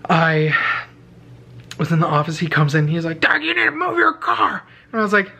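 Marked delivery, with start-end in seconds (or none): 3.2-4.6 high, mock janitor order; pitched-up bossy janitor; stern janitor. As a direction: order him to move the car in a put-on voice. Janitor demanding again.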